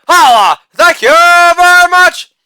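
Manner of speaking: loud "Holla", then a very high, loud "thank you very much"; hammed-up falsetto victory call; catchphrase shout. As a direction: belt out the catchphrase like a hype man.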